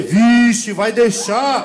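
loud mock exclamation; mock loud reaction. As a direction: call it out loud, half laughing. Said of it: "De baixo ia ser".

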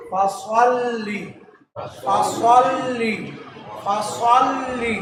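ফাসল্লি slowly, with thick rounded সোয়াদ; full heavy s and held l in ফাসল্লি; corrected heavy s. Shown as a demonstration. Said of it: "ফাসাল্লি না হয়ে হবে". (ফাসল্লি মোটা সোয়াদ দিয়ে, ধীরে)

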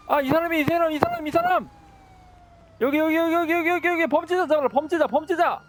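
hammed-up high screaming for help; frantic calling out. Yelling for police to catch attacker.

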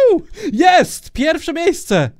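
loud, high victory shout; excited cheer. Real, not acted. Gamer cheering his first place win, loud and high.

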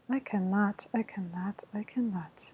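ordinary delivery

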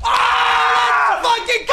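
high, loud celebration yell; triumphant scream. Real, not acted. Players celebrate surviving the spawn, triumphant scream. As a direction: scream with hype at the win.

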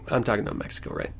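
ordinary talk, nothing marked

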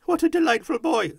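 high, loud, gleeful praise; overjoyed high voice; delighted Scrooge. A narrator's reading. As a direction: exclaim it with relish.

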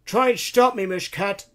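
high, loud put-on old man's voice; defiant grandpa. Queasy grandpa defying the cat conductress, loudly. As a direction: answer back loudly as grandpa.

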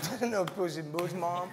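put-on high little-child voice; puzzled little kid. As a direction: imitate a five-year-old doubting his mother. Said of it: "And the kid just looked at me".

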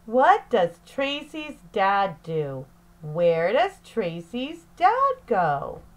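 normal talking voice

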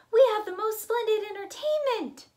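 high, loud pleading squirrel. Acted. Squirrel calling after leaving guests, high pleading. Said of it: "said Squirrel".

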